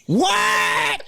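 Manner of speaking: high-pitched mimicked shout; high old-lady holler; hollering granny. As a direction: imitate a granny who can't hear, yelling back. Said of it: "And she's going".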